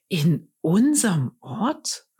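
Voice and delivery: slow, lightly astonished question; mildly amazed, drawing it out. A narrator's reading. Shepherds amazed the king comes to their town, mild astonishment. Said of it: "fragen die anderen ganz erstaunt".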